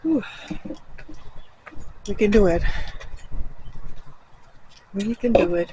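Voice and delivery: puffing on a steep climb; winded, catching breath. Real, not acted. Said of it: "This is so steep".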